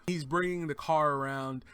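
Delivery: usual speaking voice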